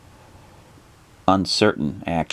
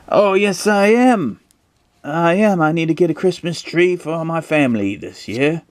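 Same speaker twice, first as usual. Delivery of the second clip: high, put-on British character voice; helpful roadside man; 11 semitones higher than usual. Roadside man after a Christmas tree, high put-on voice. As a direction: answer eagerly in a high mock British voice.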